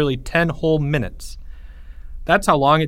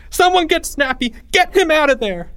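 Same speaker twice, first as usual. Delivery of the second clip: high-pitched urgent yell; panicked scream; 3 dB louder than usual. A narrator's reading. Boy begging classmates to free Snappy, screaming=. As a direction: shout desperately for help. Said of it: "he screamed".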